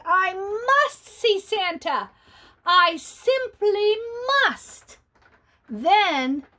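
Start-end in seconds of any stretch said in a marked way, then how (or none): 0.0-5.0 slow, higher, pleading insistence; insistent visitor. Acted. Visitor at the door insisting on seeing Santa, slow and higher. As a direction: drag out each word, begging. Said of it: "he heard the visitor say".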